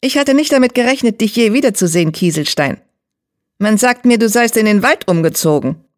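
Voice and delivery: high, sharp cat leader's voice; Geißel. A narrator's reading. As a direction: speak high and pointed. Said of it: "Die Stimme der schwarzen Katze klang hoch und spitz".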